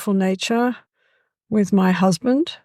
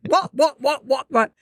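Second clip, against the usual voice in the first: budgie voice; 5 semitones higher than usual. Retold or imitated. Voicing the budgie.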